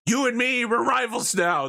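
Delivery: high, hammed-up challenge; high mock villain declaration; mock rival challenge. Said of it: "and is like".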